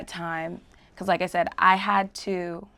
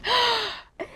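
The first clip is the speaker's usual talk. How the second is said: sharp high gasp, acted out; startled gasp. Guest showing how they react when bad things happen, mock startled gasp. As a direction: gasp as if bad news just landed.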